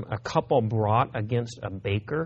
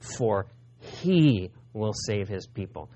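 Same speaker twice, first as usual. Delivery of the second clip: "he" set apart by pauses, slower; stress on HE; 3 semitones lower, slower than usual. Shown as a demonstration. Preacher showing how spoken English emphasizes, stress on "he". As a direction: separate the word "he" and give it weight. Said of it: "adding volume or by separating the word".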